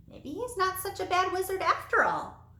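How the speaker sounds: high called-out line; called-out remark. Acted. Someone in the leaving crowd calls out about the wizard, high pitched. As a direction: call it out across the crowd. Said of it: "someone shouted".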